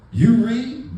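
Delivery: loud, high "You read?"; mock shocked young coworker; loud disbelief. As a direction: hit it like it's unbelievable.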